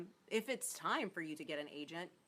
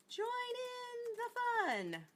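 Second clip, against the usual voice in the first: tiny, high-pitched playful voice; playful high sing-out for Twitter; much faster than usual. Pretend. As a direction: squeak it out in a silly high voice. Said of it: "my little high Twitter voice".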